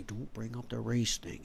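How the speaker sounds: soft, slow, hushed warning; mock hushed caution. Imitating people avoiding race, hushed mock caution. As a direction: tiptoe around the subject.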